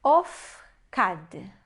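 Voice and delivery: "Ofkad" split of-kad with a pause; demonstrated reading. Shown as a demonstration. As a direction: sound out "Ofkad" slowly, O in the first syllable. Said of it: "nós temos aqui". ("Ofkad" devagar, sílaba por sílaba)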